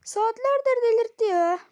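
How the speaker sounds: screaming at a teammate in rage; high, loud raging yell; furious shout. Real, not acted. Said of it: "çok bağırdım ya".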